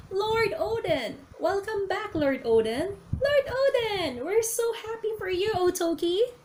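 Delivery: high, drawn-out happy cries; cheering crowd. Acted. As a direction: call out to the returning lord. The people cheering Oden's return.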